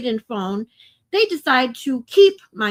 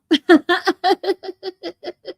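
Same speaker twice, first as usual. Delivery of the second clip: short bright burst of laughter; amused laugh; 7 semitones higher, 4 dB louder than usual. Real, not acted. Streamer laughs at the chat's joke, bright laughter.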